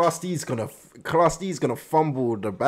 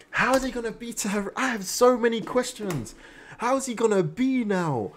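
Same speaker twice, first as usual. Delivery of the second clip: eager questions; 7 semitones higher than usual. Real, not acted. Anxious wondering after the episode.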